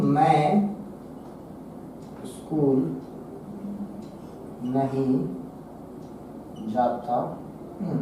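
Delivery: low, soft, long gaps between words; word-by-word dictation. Shown as a demonstration. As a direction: murmur it slowly as if writing along. Dictating while writing on board. (धीमी, नीची आवाज़ में लिखवाते हुए)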